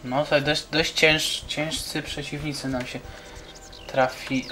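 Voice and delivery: usual speaking voice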